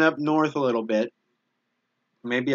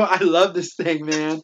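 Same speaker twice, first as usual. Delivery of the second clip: louder, slow, doting delight; gushing love; 4 semitones higher, 4 dB louder, slower than usual. Real, not acted. A junkyard-dog streamer praising his squeaky toy, doting. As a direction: dote on the toy.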